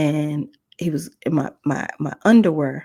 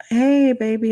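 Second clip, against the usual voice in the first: put-on higher, louder hello to a baby; baby greeting; 6 semitones higher, 5 dB louder than usual. Cousin's guest greets the crawling baby, bright baby-talk hello.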